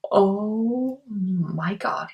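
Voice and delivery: put-on slow, stretched-out nasal "oh my god" like a sitcom girlfriend; playful slow-motion "oh my god" impression; Janice from Friends. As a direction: drag every word of "Oh my god" out very slowly, amused. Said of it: "I sound like Janice from Friends".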